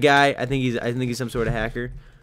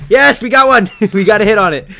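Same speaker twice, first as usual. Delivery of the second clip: high, loud cheer; triumphant shout; 6 semitones higher, 13 dB louder than usual. Real, not acted. Player scores a hit, triumphant shout. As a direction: cheer with a laugh.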